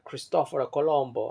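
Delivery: attempted Italian accent on Cristoforo Colombo; Italian pronunciation. Shown as a demonstration. Guessing how Italians say the explorer's name, Italian pronunciation. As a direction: say Cristoforo Colombo as Italians might, rolling through the vowels. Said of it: "how they say it in".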